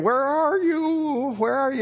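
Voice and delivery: high, slow sing-song call; playful searching parent. Retold or imitated. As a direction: pretend you can't find the hiding kids. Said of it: "so you wander around the room going".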